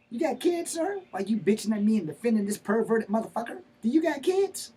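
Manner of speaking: high-pitched, heated questioning; indignant challenge. Acted. Defendant challenging the man defending a pervert, indignant and high. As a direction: fire the questions upward in outrage. Defendant angrily grilling his accuser.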